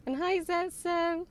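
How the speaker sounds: high, slow happy hello; excited greeting. Real, not acted. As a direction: greet them brightly.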